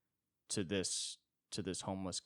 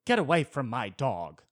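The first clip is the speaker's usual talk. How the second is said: loud sharp snap, higher; a loud put-on snap at a stranger; snapping rich lady; 5 semitones higher, 11 dB louder than usual. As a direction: bark it at the man to back off. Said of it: "she didn't want to go".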